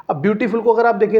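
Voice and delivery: ordinary talk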